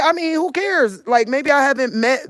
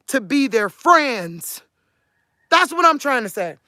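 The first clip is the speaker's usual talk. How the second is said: ordinary talk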